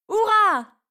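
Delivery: high joyful hurrah; cheering shout. Acted. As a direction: shout it in triumph. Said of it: "crie Zoé". (Zoé crie de joie, hurrah aigu et fort)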